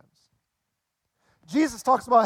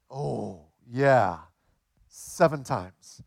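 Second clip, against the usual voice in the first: sheepish admission; 8 semitones lower, much slower than usual. Retold or imitated. Cheater admits it to Peter.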